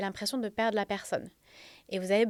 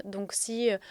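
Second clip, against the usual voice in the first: ordinary talk, nothing marked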